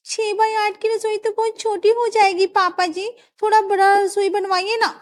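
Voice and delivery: high, sweet daughter-in-law asking a favour; young daughter-in-law. Acted. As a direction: coax the father-in-law for a bigger kitchen. Daughter-in-law voiced by narrator.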